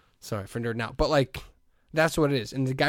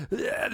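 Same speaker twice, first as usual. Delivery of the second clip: strained, higher, softer gravelly groan; mock gruff growl; 8 semitones higher, 4 dB quieter than usual. Podcaster mocking cover singers straining to sound scratchy, gruff groan. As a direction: fake a scratchy singer's rasp. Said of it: "they try to make it all gruff".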